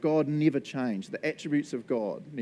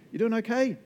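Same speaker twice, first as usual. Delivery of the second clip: high, gentle check-in; concerned question; 8 semitones higher than usual. Retold or imitated. Barista checking on an angry customer, high and kind. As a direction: ask kindly if they're alright. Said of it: "I'll be like".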